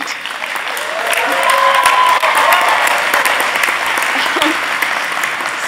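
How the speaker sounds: breathy nervous giggle; nervous laugh. Real, not acted. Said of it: "Sorry, I'm a little nervous".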